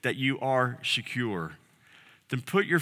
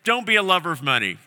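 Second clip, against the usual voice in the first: higher, faster, half-bored voice of a churchgoer; a lightly voiced churchgoer brushing it off; mock listener; 6 semitones higher, 4 dB louder, faster than usual. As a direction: play the listener shrugging along. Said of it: "you can say".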